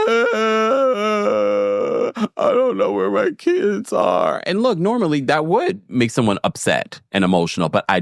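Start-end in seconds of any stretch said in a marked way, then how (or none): 0.0-4.4 high, slow, hammed-up wail; mock crying. Mia forcing out that one tear, mock crying. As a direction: fake a breakdown with drawn-out high sobbing words. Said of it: "Mia is already having a breakdown".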